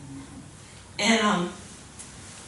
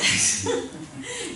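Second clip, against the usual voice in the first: high burst of laughter; real laugh; 10 semitones higher than usual. Real, not acted. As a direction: laugh out at the memory. Recalls calling the deacon.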